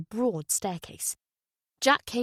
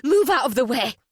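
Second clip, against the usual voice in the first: louder, higher curt command; demanding; 4 semitones higher, 6 dB louder than usual. Acted. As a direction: order them aside, no sympathy. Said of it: "Butterfly demanded".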